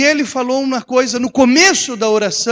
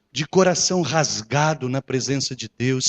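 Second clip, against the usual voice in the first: ordinary talk, nothing marked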